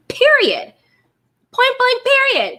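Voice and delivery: high, loud Period and Point blank; emphatic period. Real, not acted. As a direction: slam it shut, every word punched. Emphatic closing punchline.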